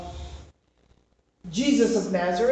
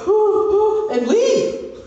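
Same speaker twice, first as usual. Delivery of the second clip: frightened crowd; 13 semitones higher, 7 dB louder, much slower than usual. Retold or imitated. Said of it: "and everybody, like".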